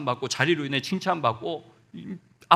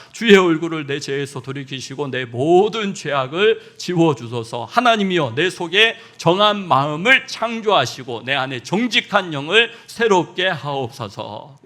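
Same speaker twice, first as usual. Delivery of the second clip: scripture reading; 8 dB louder than usual. Real, not acted. Congregation reads psalm aloud.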